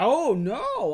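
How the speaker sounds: high, loud, drawn-out mock dismay; hammed-up shocked protest. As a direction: wail it like a wounded guest of honour. Hero acted out being thrown out.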